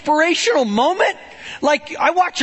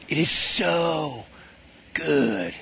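slow, low, drawn-out praise; awed God; 8 semitones lower, 4 dB quieter, much slower than usual. Retold or imitated. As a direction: savour every word like a proud creator. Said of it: "he's like".

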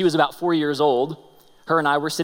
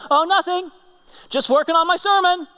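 much higher, lightly played child voice; casual little girl; 14 semitones higher, 5 dB louder than usual. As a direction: toss it off like a busy child. Daughter voiced in story.

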